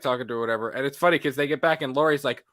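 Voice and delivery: ordinary talk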